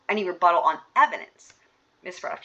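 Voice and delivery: normal talking voice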